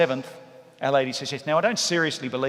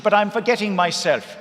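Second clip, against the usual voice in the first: Mary's voice; 6 semitones higher, 6 dB louder than usual. Retold or imitated. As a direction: lay on the admiration like an old Irish matriarch. Mary catches herself.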